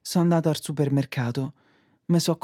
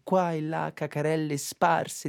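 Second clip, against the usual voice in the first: mock poem recital; 2 semitones lower, 3 dB quieter than usual. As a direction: recite like a poet, deadpan. Poem-like monologue, lower, softer.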